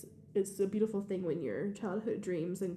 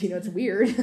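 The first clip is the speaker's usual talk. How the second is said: laughing; 4 semitones higher, 13 dB louder than usual. Real, not acted. Laughing about grown students.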